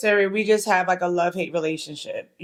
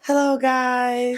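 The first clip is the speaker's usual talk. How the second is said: slow, drawn-out podcast greeting; 3 semitones higher, much slower than usual. Acted. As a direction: put on a measured podcast voice. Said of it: "this is my podcast voice".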